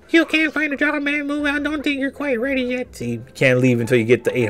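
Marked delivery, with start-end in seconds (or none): 0.1-2.9 high-pitched scolding mom voice; high mock stepmom. Voicing the game stepmom refusing him, high and dismissive.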